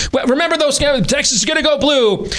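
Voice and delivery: high mock-hopeful voice; sing-song fake optimism. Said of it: "We're all".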